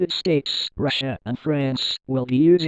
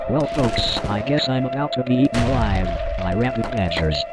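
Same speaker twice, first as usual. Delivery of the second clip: ordinary delivery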